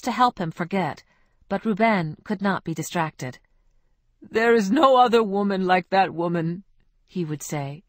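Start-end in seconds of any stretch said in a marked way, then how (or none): 4.2-6.6 louder, insistent lovesick man; stubborn lament. Acted. Ruben insisting to friends, louder and stubborn. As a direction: refuse to be consoled. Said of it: "shaking his head stubbornly".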